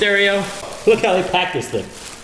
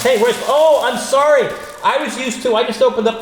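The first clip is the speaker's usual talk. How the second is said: surprised; 11 semitones higher, 4 dB louder than usual. Real, not acted. Surprised, catching himself.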